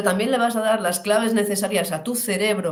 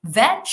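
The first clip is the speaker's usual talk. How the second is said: Veg said louder, alone; stressed syllable; 4 semitones higher, 4 dB louder than usual. Shown as a demonstration. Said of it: "la sílaba tónica".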